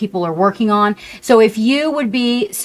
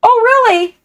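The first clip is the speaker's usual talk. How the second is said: surprised; 14 semitones higher, 4 dB louder than usual. Real, not acted. Reacting to chat message.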